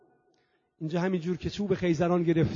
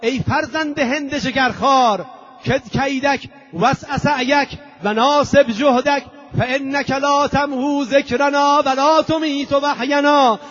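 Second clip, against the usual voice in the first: raised, defiant oration building louder; ringing denunciation of a tyrant; crying out; 7 semitones higher, 6 dB louder, slower than usual. Acted. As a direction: declaim it high and forceful, stretching the phrase endings. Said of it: "فریاد زد".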